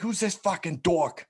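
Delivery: high, slow mocking Jersey accent; Jersey accent yell. Older local skater yelling at a kid, in a mocking Jersey accent. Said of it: "thick ass Jersey accent".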